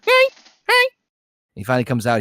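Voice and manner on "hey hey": short, high-pitched mock yelps; imitated yelps. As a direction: yelp twice, high and sudden. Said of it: "and just hear".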